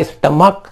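स्टमक ending in hard "k". Shown as a demonstration. Teacher showing CH in स्टमक read as "k". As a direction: say स्टमक with a final क. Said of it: "ये सीएच है लेकिन क पड़ा".

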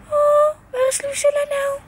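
high, hammed-up worried call for a lost child; mock worried mama. Mother to toddler, acting out searching for her in mock panic. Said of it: "En dan mama overal zoeken".

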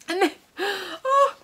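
high gasp of excitement; overexcited. Real, not acted. Seeing the next fabric, overexcited. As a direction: gasp mid-sentence in delight. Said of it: "sorry, I'm getting so overexcited".

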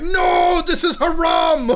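high, loud, hammed-up wail; mock screaming. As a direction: imitate a distraught gamer crying out in protest. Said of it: "he's just screaming away".

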